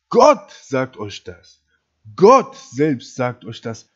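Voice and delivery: slow, with GOTT punched loud; weighty, loud sermon emphasis; emphatic preaching. Real, not acted. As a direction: hammer the first word, then drive on slowly.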